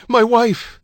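high, loud cry; desperate shout. A narrator's reading. Husband to police holding back the crowd, shouting. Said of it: "he shouted".

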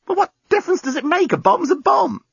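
very high, heated retort; exasperated retort. A narrator's reading. Lucy argues back at the journalist, exasperated.

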